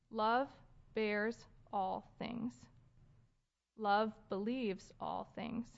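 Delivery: slow, measured reading, letting each phrase stand for listeners to consider; slow scripture reading. Real, not acted. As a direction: read slowly with long pauses between phrases. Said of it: "a little slowly".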